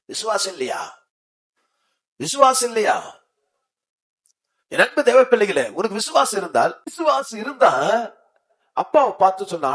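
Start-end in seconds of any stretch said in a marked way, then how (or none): 2.2-3.2 high, raised preaching shout; forceful challenge. Real, not acted. Preacher challenging the congregation about faith, forceful shout. 4.7-9.7 high, loud exhortation; impassioned preaching. Real, not acted. Preacher urging God's children to have faith, impassioned.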